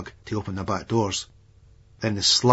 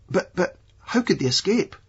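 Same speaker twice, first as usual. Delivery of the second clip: high, stammering panic; flustered stammer; 9 semitones higher than usual. Retold or imitated. A nervous man asking how dogs escaped, high flustered stammer. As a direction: stumble over the words in alarm.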